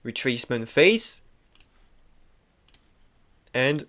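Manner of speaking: a mock English accent on "Retracement phase"; jokey English accent, unhurried. Shown as a demonstration. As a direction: say it like an Englishman, lightly. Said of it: "merci pour mon accent anglais".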